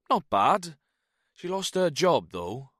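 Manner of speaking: high, loud Welsh reply; breezy Welshman; Welsh accent. A narrator's reading. Jones tells Snow about his wife in a Welsh accent. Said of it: "The Welshman's accent".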